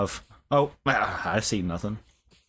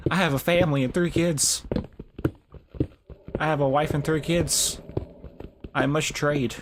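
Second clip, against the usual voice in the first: wandering trader voice; 7 semitones higher than usual. Retold or imitated. Gamer voices the wandering trader begging for his life, higher pleading. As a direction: beg like a desperate trader with a family. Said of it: "It's like".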